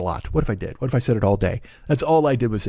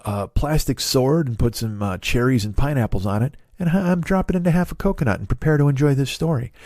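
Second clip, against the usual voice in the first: ordinary talk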